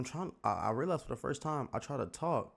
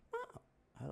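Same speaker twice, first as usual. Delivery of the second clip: very high, soft squeaky call; squeaker voice; 7 dB quieter than usual. Shown as a demonstration. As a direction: try to squeak like a little kid. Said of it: "let me see if I could".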